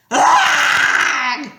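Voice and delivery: loud grunting cry of pain; pained yell. A narrator's reading. Medusa hit again, yelling in pain. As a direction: cry out as the branch hits. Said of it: "Medusa yelled".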